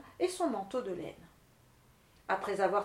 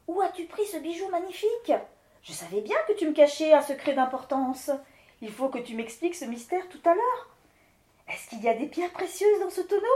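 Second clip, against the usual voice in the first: high, eager, curious wife voice; the wife gushing over the silver barrel; excited wife Claudine; 3 semitones higher, 5 dB louder than usual. Acted. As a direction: pester him for the secret.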